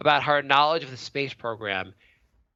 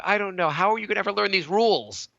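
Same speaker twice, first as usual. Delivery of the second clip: ordinary talk, nothing marked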